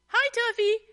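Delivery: called out loud and high across the fence; calling out. A narrator's reading. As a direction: hail him cheerfully from a distance. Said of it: "Stevie called from outside the fence".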